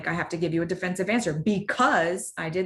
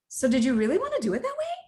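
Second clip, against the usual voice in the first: fast, high doubting question; 6 semitones higher, faster than usual. Shown as a demonstration. To a colleague, exaggerated questioning tone. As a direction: lift it like you doubt them. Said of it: "exaggerated so you can hear my pitch".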